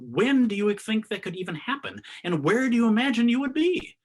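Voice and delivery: building to a high, loud preaching peak. Acted. Zen teacher challenging the sangha's idea of later, preaching. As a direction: climb into full preaching force. Rising rhetorical questions to listeners.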